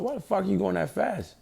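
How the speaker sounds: high-pitched mock outrage from a cop; cussing cop. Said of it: "he cussing at me too".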